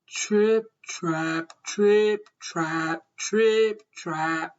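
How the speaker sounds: stomp out "Trip, trap" slowly, alternating strong and low; big hoofsteps. A narrator's reading. Biggest goat's heavy hooves on bridge.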